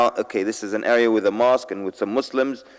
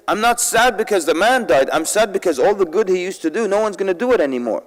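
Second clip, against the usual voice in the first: higher, more animated priest's answer; livelier retelling; 7 semitones higher than usual. Shown as a demonstration. Preacher retelling the priest's words to a sleepy crowd, animated and higher. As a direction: lift the voice to wake the crowd up. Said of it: "we're just trying to wake everybody up".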